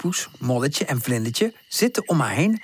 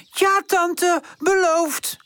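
calling out; much slower than usual. Acted. Friends promising tante, calling out. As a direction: call it out together. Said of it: "roepen ze".

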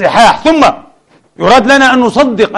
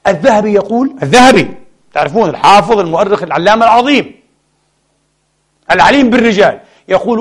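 usual speaking voice

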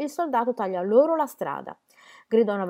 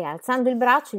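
usual speaking voice

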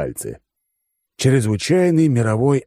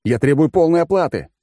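angry demand; 8 semitones higher, 4 dB louder than usual. Acted. Furious demand continues.

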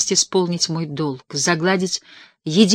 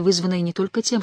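plain reading voice

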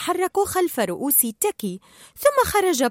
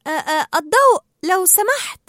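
higher, louder polite stammer; hesitant request; 7 semitones higher, 5 dB louder than usual. Acted. Dory in sudden dark asks for light, hesitant. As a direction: ask politely with a halting start.

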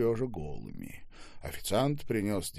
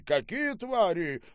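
high, drawn-out indignation; outraged exclamation; 11 semitones higher, 4 dB louder than usual. Acted. Boss shakes his head after the manager flees, outraged. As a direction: draw out the disgust.

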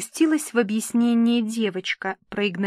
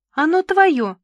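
high, loud promise; gushing indulgence; 8 semitones higher, 7 dB louder than usual. A narrator's reading. Papa promises Nina her dream dress, gushing indulgently. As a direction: grant her wish effusively.

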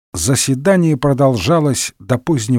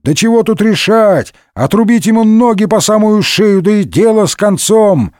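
raised-pitch rowdy heckle; heckling; 7 semitones higher than usual. A narrator's reading. Rowdy member heckling the speaker in parliament, raised voice. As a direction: shout it out from the benches. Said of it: "Парламентарии зашумели, засвистели и затопали".

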